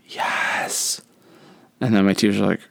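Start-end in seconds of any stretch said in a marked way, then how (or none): 0.1-1.0 hushed, whispered mock cheer; whispered triumph. Pupil secretly celebrates his friend covering for him, whispered triumph. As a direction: whisper it like a secret little victory.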